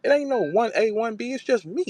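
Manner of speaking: high mock voice of a cocky female rapper; high, boastful mock female rapper; imitating Jazz. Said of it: "she said".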